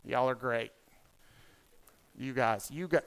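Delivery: ordinary delivery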